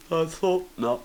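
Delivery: high storybook bear voice; lightly voiced toy bear; Winnie the Pooh. A narrator's reading. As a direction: pitch it up like a small bear. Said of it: "said Winnie the Pooh".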